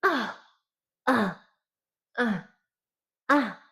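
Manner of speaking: high, sharp pained grunts; short high-pitched damage cries. Shown as a demonstration. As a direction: yelp it up high like a hurt hero. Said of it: "a higher pitch voice".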